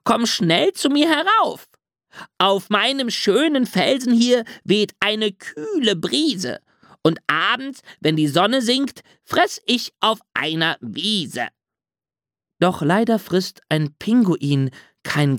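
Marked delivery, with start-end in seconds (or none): 0.0-11.5 hohe, meckernde Ziegenstimme; meckernde Ziege. A narrator's reading. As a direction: warn ihn wie eine aufgeregte alte Ziege. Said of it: "so meckerte die Ziegenfrau". (hoch und meckernd)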